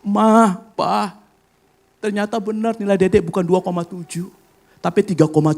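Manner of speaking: tearful daughter. Retold or imitated. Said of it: "dia sambil nangis bilang gini".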